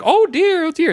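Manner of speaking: very high, loud fretting mother; hammed-up motherly dismay; flustered religious mom. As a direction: imitate a prim mom in a tizzy.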